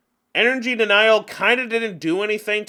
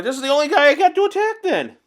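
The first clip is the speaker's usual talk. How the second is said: frantic complaining; 9 semitones higher, 5 dB louder than usual. Retold or imitated. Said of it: "because I'm like".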